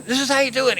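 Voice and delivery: loud, high excited instruction; eager showing-off. Retold or imitated. Friend grabs the bag to show him how, eager and loud.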